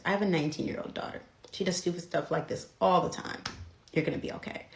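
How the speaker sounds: soft, calming retold reassurance; reassuring officer. Officer comforts the scared nineteen-year-old, soft and reassuring. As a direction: soothe her quietly like an understanding parent.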